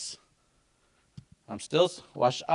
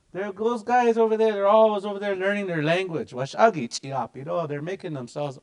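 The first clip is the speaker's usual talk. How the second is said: higher, louder gossip; gossiping community member; 6 semitones higher, 3 dB louder than usual. Retold or imitated. Community onlooker remarking on language learners, higher and louder. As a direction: talk about them from across the room. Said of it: "some people might say that in the community".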